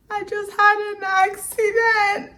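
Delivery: high, drawn-out tearful wail; mock crying. Daughter telling dad about the crash, mock-crying. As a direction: imitate yourself wailing slowly down the phone. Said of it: "I'm calling them back crying".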